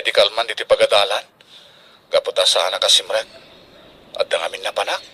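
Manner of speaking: worried hush. Acted. Worried father fading out.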